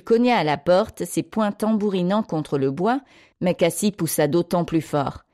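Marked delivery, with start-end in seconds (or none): none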